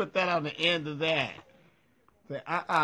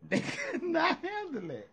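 high squeal; 13 semitones higher than usual. Real, not acted. Squealing emphasis in laughter.

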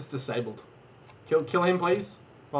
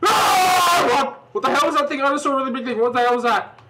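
high, loud terrified yelling; panicking gamer; 14 semitones higher, 7 dB louder than usual. Real, not acted. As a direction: scream in panic at the monster. Giant monster appears in game.

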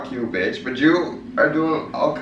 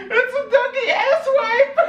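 squealing with laughter, pitched way up; laughing hard. Real, not acted. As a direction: gasp it out through helpless laughter. Cracking up over the taste.

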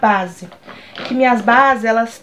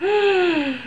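shocked gasp; 7 semitones higher, 4 dB quieter than usual. Real, not acted. Shocked at her face.

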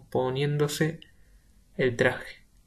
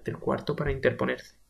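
ordinary delivery

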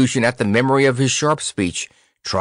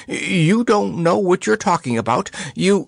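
high, indignant retort; heated retort; 10 semitones higher than usual. Acted. Percy arguing back at Grandpa, heated retort. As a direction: cut in with high-pitched protest. Character interrupts in an argument.

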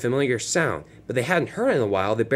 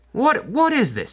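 shocked; 12 semitones higher, slower than usual. Acted. Boss finds no progress made.

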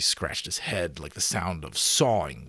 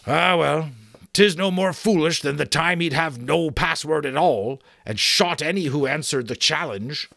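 resigned giant; 7 semitones higher, 5 dB louder than usual. Acted. Giant resigns himself to absurd password.

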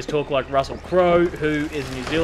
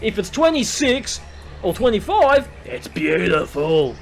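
high, loud voice easing down on 'beautiful'; loud enthusiastic rant; 6 semitones higher, 5 dB louder than usual. Real, not acted. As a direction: exclaim it high and loud, then stretch the last word. Ranting in favour of Celsius.

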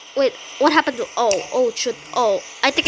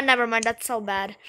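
usual speaking voice

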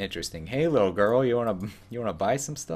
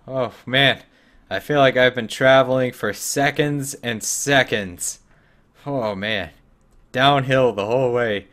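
hammed-up weary groaning, louder; mock tired; 3 semitones higher, 7 dB louder than usual. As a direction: pretend to be worn out from a tiny walk. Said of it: "oh, I'm getting tired".

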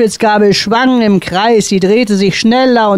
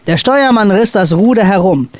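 plain reading voice

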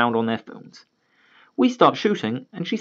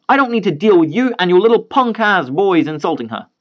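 loud, higher angry father; heated argument; 7 semitones higher, 3 dB louder than usual. Retold or imitated. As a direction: push back hard at them. Father argues with parents.

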